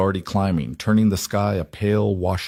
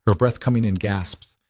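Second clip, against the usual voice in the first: ordinary delivery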